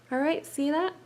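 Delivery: usual speaking voice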